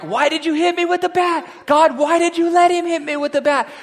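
high, loud, indignant howl; outraged cry. Pretend. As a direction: yell at God in pain and outrage. Hurt victim crying out to God.

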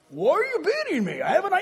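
high, hurt talking-horse voice with a laugh; Mister Ed voice. Retold or imitated. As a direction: plead like a wounded TV horse. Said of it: "like Mister Ed".

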